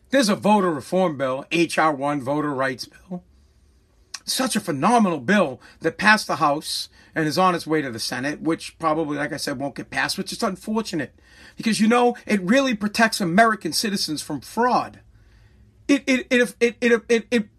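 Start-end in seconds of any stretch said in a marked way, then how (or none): 0.1-11.2 much softer, lower, deadpan murmur; mock serene understatement; sarcastic calm. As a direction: pretend to be calm while seething. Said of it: "So let's not be upset".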